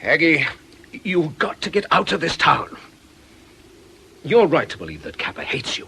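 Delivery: ordinary talk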